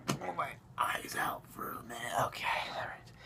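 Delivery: very soft, voiceless mutter after a groan; spent, hushed aside; strained near-whisper. Pretend. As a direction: let the voice drop away to a whisper. Recovering from the cringe groan.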